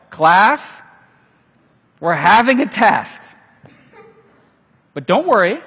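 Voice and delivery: loud, bright announcing teacher; mock teacher. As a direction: play a teacher breaking news to the class. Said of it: "It's like".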